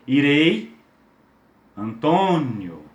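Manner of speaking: 'Irei' and 'Antônio' said slowly and drawn out; teacher modelling the vowel sounds; demo words. Shown as a demonstration. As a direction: spell out 'Irei' then stretch 'Antônio'. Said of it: "Como o exemplo que eu usei".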